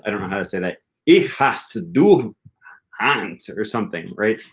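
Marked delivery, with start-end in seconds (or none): 1.1-3.4 slow imitation of a German lesson; slow demonstration. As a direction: spell it out slowly, as if teaching beginners. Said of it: "does it slowly".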